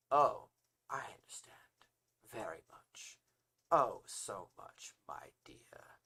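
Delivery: slow, breathy whisper with long pauses; intimate whisper. Acted. Braxton answers Mom's plea, slow intimate whisper. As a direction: whisper it slowly, savouring each phrase.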